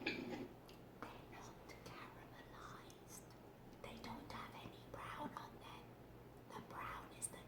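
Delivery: soft whispered aside; whispering. Real, not acted. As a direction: whisper it under your breath.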